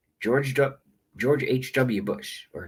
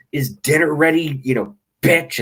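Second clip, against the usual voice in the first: louder, higher mock barking husband; mock drunk husband; 5 semitones higher, 4 dB louder than usual. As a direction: play the half-drunk man home from work. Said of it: "He's like".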